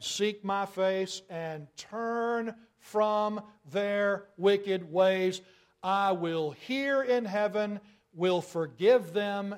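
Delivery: slow, intoned and weighty; solemn scripture reading. Acted. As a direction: read it as a holy promise. Reading God's promise.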